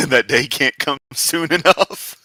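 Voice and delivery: slow, giggling tease; laughing. Real, not acted. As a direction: tease him while cracking up. Joking back at the clip.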